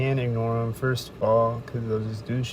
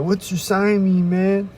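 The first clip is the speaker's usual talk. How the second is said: higher, slow, drawn-out pestering; mock pushy stalker; 8 semitones higher, 5 dB louder, slower than usual. Stalker walks up demanding what the sign means, mocking. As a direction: play the nagging stranger, stretching each question. Stalker touches his shoulder.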